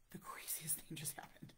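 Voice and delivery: urgent whispered news. Retold or imitated. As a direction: whisper it like a secret. Telling husband news outside car.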